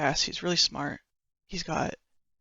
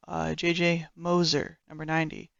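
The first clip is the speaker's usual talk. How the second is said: ordinary talk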